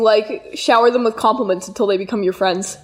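ordinary delivery

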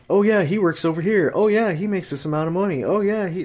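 high, eager voice rattling off his info; mocking chatty friend. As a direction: play the clueless friend spilling details.

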